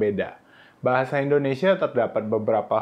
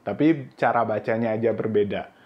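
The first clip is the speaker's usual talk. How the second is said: normal talking voice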